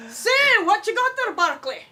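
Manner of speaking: a lightly put-on dwarf asking about the brew; hearty dwarf. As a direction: boom it out like a jolly dwarf. Retake of dwarf line.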